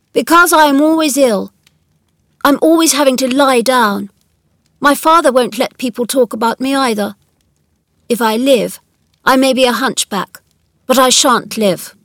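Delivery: put-on emphatic young boy explaining himself; sickly, imperious boy. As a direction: voice a self-important invalid child. Narrator voices Colin.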